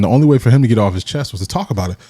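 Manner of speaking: ordinary talk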